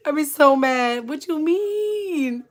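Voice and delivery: high, loud pretend indignation, drawn out at the end; mock outrage. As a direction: play-act being mad, stretching the question into a wail. Imagines being mad at mom.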